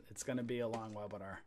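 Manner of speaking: a soft, low mutter to himself; muttered aside. Real, not acted. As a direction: mutter it under your breath like you're thinking aloud.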